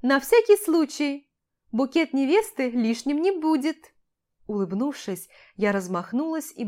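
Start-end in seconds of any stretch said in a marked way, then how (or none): 0.0-3.9 high, loud, animated retort; insistent mother-in-law. A narrator's reading. Mother justifying herself to her son, high insistent voice. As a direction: answer back in a raised, lively voice.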